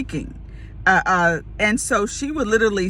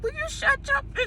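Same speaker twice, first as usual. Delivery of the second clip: very high, softer strained squeal; frantic hushing; 6 dB quieter than usual. Retold or imitated. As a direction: imitate desperately shushing your sister. Retold desperate hushing of sister.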